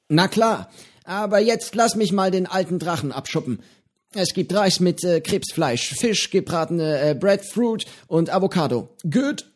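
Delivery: höhere, lockere Piratenstimme, freundlich plaudernd; Johnny, der alte Pirat, prahlt gemütlich mit seinem Fisch; gutmütiger Pirat Johnny. A narrator's reading. Johnny zählt das Essen auf. (höhere Stimme eines gutmütigen alten Piraten)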